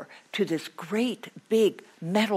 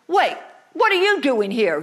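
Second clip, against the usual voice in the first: higher, louder flustered blurt; boy caught, flustered, turning the question back; 5 semitones higher, 9 dB louder than usual. Acted. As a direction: stammer it back at her.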